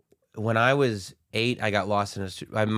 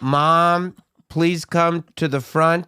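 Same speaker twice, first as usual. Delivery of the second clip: very slow, drawn-out, louder and higher loudspeaker call; store PA announcement; 5 semitones higher, 6 dB louder, much slower than usual. Retold or imitated. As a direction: stretch it out like a store intercom page. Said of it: "And they go".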